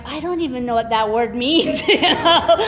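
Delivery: laughing through the words. Retold or imitated. Grandma amused by the four-year-old, laughing. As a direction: chuckle while saying it. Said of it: "I'm like".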